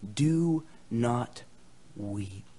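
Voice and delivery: low, soft consolation with pauses; gentle encouragement. Acted. Preacher encouraging guys and gals, gentle comfort. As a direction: comfort them quietly, word by word. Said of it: "my words of encouragement to you".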